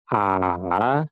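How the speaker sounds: long A dipping then climbing; falling-rising tone A. Shown as a demonstration. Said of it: "turun, setelah itu naik". (A turun, setelah itu naik)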